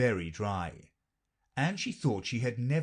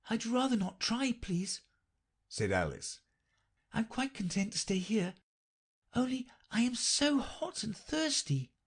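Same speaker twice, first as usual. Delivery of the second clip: polite Alice; 12 semitones higher than usual. A narrator's reading. Alice declines to run, high and polite.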